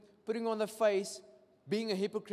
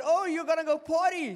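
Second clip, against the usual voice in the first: higher and louder, teasing surprise; mock surprise; 10 semitones higher, 9 dB louder than usual. Preacher retells asking the lady about partying, loud mock surprise. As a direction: feign shock at her plans.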